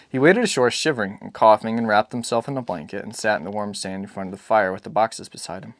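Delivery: ordinary delivery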